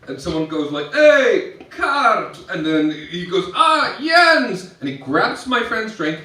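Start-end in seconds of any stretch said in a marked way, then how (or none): 0.9-2.4 high, loud hail to a friend; calling out. Retold or imitated. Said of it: "I hear a voice behind me". 3.6-4.8 high, loud shouted greeting; hailing a friend. Retold or imitated. Greeting a friend across the bar.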